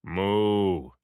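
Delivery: insistent cow lowing; stern cow moo. Acted. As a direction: moo to interrupt. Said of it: "interrupted my stern friend".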